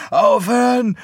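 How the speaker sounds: high, loud desperate yell; shrill cry for it to stop; panicked scream. Acted. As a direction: scream it out from inside the sack. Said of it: "schrie er".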